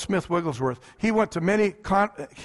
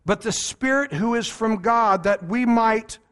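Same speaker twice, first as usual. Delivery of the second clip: slow, louder, solemn Bible reading; scripture reading; 2 semitones higher, 4 dB louder, slower than usual. Acted. A preacher reading the verse aloud, slow and solemn. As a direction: declaim the verse to the congregation.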